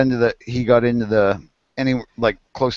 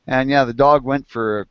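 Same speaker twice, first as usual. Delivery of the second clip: normal talking voice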